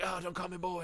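soft hurt protest; mock beaten-up whimper. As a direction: say it like you just got punched. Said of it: "as I'm all beat up".

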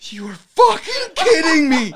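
high, loud laughing disbelief; laughing mock outrage; exasperated shout. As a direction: blurt it, half laughing. Exasperated outburst.

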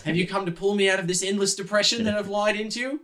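high, melodramatic character voice; despairing, high-voiced wonder; overwrought Yuri. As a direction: plead in awe to a spirit guide. Game master plays despondent Yuri.

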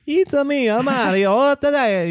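high, loud cartoon Italian accent with a laugh slipping in; Mario Italian accent. Mocking the cartoon plumber for a cohost, loud cartoon Italian accent. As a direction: ham up the caricatured Italian accent. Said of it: "caricature of the Italian accent".

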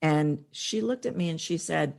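ordinary talk

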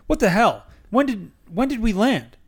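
usual speaking voice

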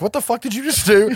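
indignant disbelief at the thrown object; incredulous anger. Retold or imitated. Max outraged after Cole throws something at him, high incredulous. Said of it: "And Max is like".

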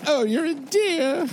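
flattered. Acted. Lady flattered by compliment.

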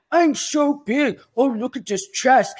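high, loud, drawn-out teasing; mocking taunt. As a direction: make fun of the muscle man in a put-on voice. Brian teasing big husband, retold.

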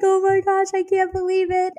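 high, disbelieving squeal; amazed delight. Retold or imitated. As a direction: sister can't believe the news. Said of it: "she was like".

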